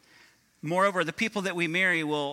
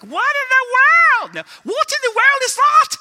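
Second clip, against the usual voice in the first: put-on much higher, louder shocked Scottish-accented lady; mock Scottish lady; 14 dB louder than usual. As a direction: ham up a scandalised Scottish lady shrieking. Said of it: "that was more Scottish".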